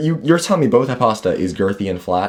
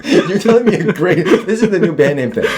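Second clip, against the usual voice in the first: laughing; 7 semitones higher, 4 dB louder than usual. Real, not acted. Laughing at t-shirt joke.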